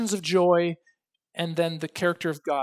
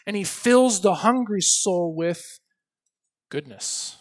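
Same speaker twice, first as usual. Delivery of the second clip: declaimed scripture reading; 4 dB louder than usual. Acted. Preacher reading a psalm of thanks to the congregation, declaimed. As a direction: ring it out like a call to praise. Reads psalm aloud with force.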